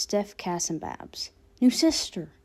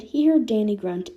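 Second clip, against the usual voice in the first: ordinary delivery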